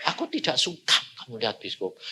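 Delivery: a calm inner voice speaking gently; voice of God. Acted. God quietly tells the preacher he dislikes the film, hushed and calm.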